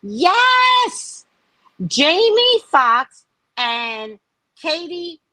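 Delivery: excited. Real, not acted. Excited reveal of secret gossip.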